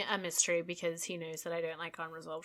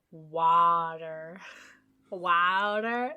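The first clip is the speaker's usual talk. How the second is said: Water in an American accent, wah-der with a flapped d and r; 3 semitones lower, much slower than usual. Shown as a demonstration. Co-host tries the American way to say Water. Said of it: "Like American".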